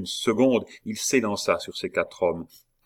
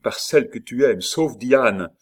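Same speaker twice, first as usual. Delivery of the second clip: higher, louder plea; raised, pressing appeal; urgent entreaty; 5 semitones higher, 6 dB louder than usual. A narrator's reading. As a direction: beseech your friend forcefully. Desperate plea in a fight.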